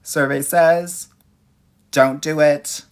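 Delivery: slow, loud game show reveal; game show host. Pretend. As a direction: boom it out like a quiz host.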